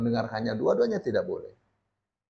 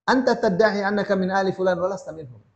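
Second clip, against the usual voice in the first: higher, louder formal reading of the Arabic; Arabic text recitation; 7 semitones higher, 5 dB louder, faster than usual. Acted. Teacher reads the Sheikh's Arabic words to the congregation, formal recitation. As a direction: recite the quoted Arabic like a text being read out.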